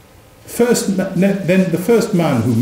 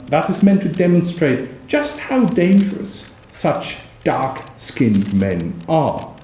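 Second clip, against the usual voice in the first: usual speaking voice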